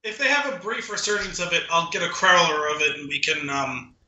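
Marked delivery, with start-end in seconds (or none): none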